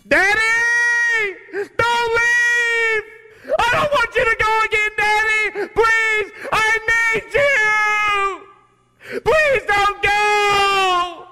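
put-on high sobbing screams of a child begging; screaming little girl. Ten-year-old daughter begging her daddy at the airport, sobbing high scream. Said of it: "This is what she was screaming out".

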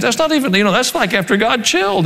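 ordinary talk, nothing marked

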